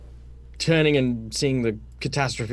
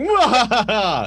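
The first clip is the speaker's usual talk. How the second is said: villain laugh; 7 semitones higher, 5 dB louder, much slower than usual. Retold or imitated. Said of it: "The warlock's like".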